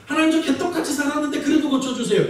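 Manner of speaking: shameless prayer. Retold or imitated. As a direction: ask boldly with no shame. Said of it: "이건, 어, 벼룩도 낯짝이 있죠". (뻔뻔하게 높은 목소리로 하는 기도)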